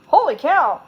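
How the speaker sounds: loud, high burst of surprise; a delighted gasp of Holy cow; excited exclaim. A narrator's reading. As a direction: blurt it out, amazed. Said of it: "exclaimed Carolyn".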